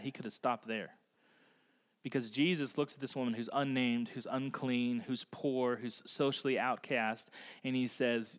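ordinary talk

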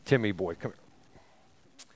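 low, offhand, fading to a whisper; casual mock call. Paul pictured calling Timothy over casually, mock offhand. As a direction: lightly mimic a lazy buddy calling over. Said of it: "He's not just like".

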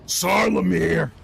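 loud, high, drawn-out roar of a name; enraged dragon bellow; dragon's roar. Acted. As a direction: roar it out slowly like a vengeful dragon. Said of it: "she roars".

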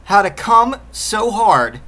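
drawn out like a quoted title; air quotes. Pretend. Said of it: "I'm gonna put this in finger quotes".

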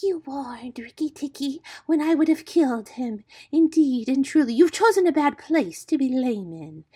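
sinister storybook snake voice; hissing snake. A narrator's reading. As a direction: hiss a threat at helpless prey. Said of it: "lifted up her head and hissed".

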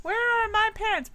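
pitched high, baffled question; mock confusion. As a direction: ask it like you just woke up lost.

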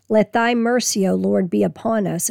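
ordinary talk, nothing marked

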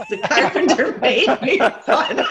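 breaking up while telling it; words bursting out with laughter; laughing. Real, not acted. Laughing at own funnel story.

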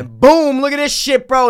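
loud, high bragging to friends; retold burst of excitement; excited boast. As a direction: crow it down the phone to your buddies.

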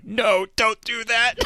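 high, slow, drawn-out mock plea; urgent warning. Co-host imitates Sam warning the bard off a spell, mock alarm. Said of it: "Sam is sitting next to her and going".

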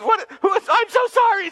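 high, loud flustered apology; high, loud mock-mom apology. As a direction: stammer it like an embarrassed mother.

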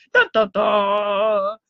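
low, slow mock doom sting; dramatic sting. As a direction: hum it like a movie cliffhanger. Ominous sting after disaster title.

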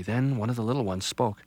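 ordinary delivery

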